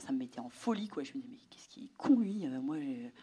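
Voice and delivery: colère chuchotée entre les dents; angry whisper. Retold or imitated. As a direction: souffle son exaspération. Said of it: "j'étais hyper énervée". (colère murmurée)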